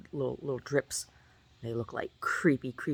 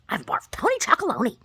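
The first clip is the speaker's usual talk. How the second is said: squeaky; 13 semitones higher, 7 dB louder than usual. Real, not acted. Mock brand name, squeal.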